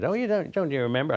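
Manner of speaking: a half-hearted American accent, admittedly bad. Shirley MacLaine reminds Maggie Smith, in a weak American accent. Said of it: "I can't do American accent very well".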